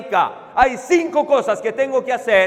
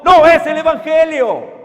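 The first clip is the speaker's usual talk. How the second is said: emphatic loud warning; 5 semitones higher, 8 dB louder than usual. Real, not acted. Preacher warning his congregation, loud and emphatic. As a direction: raise the voice sharply on the denial. Preacher warns against works-based gospel.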